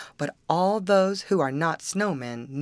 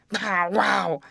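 higher hoarse barked words; hoarse dog bark; 4 semitones higher, 4 dB louder than usual. Acted. Dog unable to bark like others, hoarse. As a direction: bark it as if the voice is worn. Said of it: "can't speak like other dogs".

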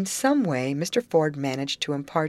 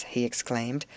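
ordinary delivery

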